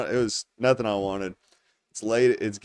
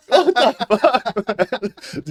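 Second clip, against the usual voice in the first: high, loud, drawn-out exclamation through laughter; retold outburst breaking into a laugh; laughing disbelief; 11 semitones higher, 8 dB louder, much slower than usual. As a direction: crack up while acting out your disbelief.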